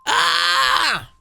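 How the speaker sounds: sudden high scream cut short; shocked high death cry. Acted. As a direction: cry out as the blow lands. Crane struck dead.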